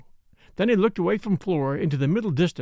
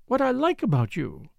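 higher, lightly malicious teasing; touch of malice; 7 semitones higher than usual. A narrator's reading. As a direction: needle him playfully in a higher woman's voice. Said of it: "with a touch of malice".

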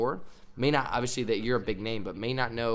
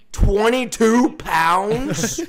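"Twenty-two pounds?" drawn out slowly, higher and louder; stunned disbelief; 8 semitones higher, 11 dB louder, much slower than usual. Retold or imitated. Player repeating the coach's target to himself, slow and stunned.

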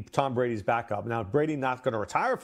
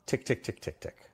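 ticking clock; 3 semitones lower, 4 dB quieter than usual. Pretend. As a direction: tick tick fading into a whisper. Clock running out on a career.

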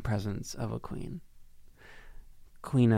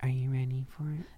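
breathy whispered song line; whispered lyric; 6 dB quieter than usual. Acted. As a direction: whisper it like a teaser.

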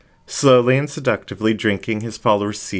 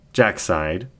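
ordinary delivery

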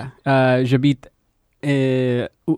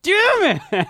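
mock outburst. Real, not acted. Forgot they said Lakers.

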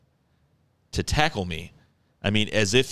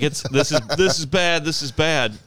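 higher, louder mock alarm; imitated panic; 7 semitones higher, 4 dB louder than usual. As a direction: fret like a panicking friend. Retold panic of hurt friend.

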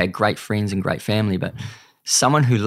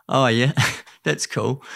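laughing; 4 semitones higher, slower than usual. Retold or imitated. Retells his own reply, laughing.

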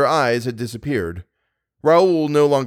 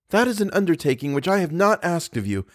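put-on higher, haughty young woman; 8 semitones higher than usual. A narrator's reading. Girl refuses the young man's undertaking, haughtily. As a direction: refuse him proudly, pitch rising. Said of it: "said the young girl haughtily".

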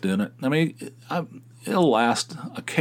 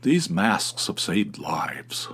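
slow mock whisper, preachy; slow breathy mock-solemn clerk; 4 semitones lower, much slower than usual. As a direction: hiss it out like a sanctimonious scold. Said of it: "the guy goes".

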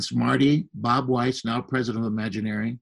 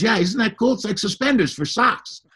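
high, eager boast about the socks; pitched up with childlike pride; delighted; 10 semitones higher, 4 dB louder than usual. Retold or imitated. Walt boasting to a boy about his sock garters, high and delighted. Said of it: "And he goes".

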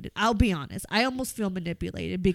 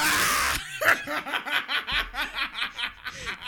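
burst of laughter; 5 semitones higher, 4 dB quieter than usual. Real, not acted. Laughing at being unfollowed.

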